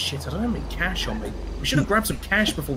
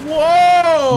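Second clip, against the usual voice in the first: surprised shout; 11 semitones higher, 11 dB louder than usual. Acted. Sees custom helmet.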